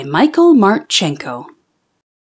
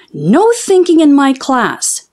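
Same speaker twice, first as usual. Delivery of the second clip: higher, firm teacher voice; strict teacher; 6 semitones higher than usual. Acted. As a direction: lay down the rule. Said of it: "said Missus Gomez".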